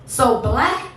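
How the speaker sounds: punchy performance poetry with rising set-ups and long pauses; spoken-word poem. Acted. Poet boasting to an audience about mama, proud spoken-word delivery. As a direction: proclaim each line, then land the refrain.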